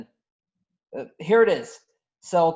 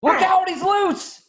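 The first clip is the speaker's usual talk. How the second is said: higher, louder, slow warning yell; alarmed shout; 13 semitones higher, 5 dB louder, slower than usual. Acted. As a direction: shout a warning across the room. Wookiee breaks loose, alarm.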